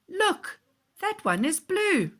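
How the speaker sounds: put-on high, eager child pointing; excited child. Child spotting a blue bubble, high and excited. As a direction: squeal it out like a delighted kid.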